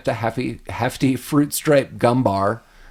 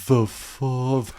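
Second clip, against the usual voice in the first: slow, stretched-out imitation; slowed-down tape voice; 5 dB quieter, slower than usual. Kevin posing as his dad on the phone, slowed-down voice. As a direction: drag each word like a slowed recording. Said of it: "slows down".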